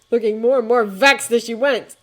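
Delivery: ordinary delivery, nothing marked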